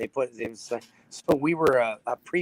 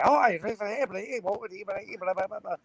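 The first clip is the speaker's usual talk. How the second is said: higher, quick Canadian babble; Canadian accent; 7 semitones higher than usual. Retold or imitated. Friend mimics Canadian accent returning after drinks, higher-pitched babble. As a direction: slip into a Canadian accent after a couple of beers. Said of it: "all of a sudden it comes right back".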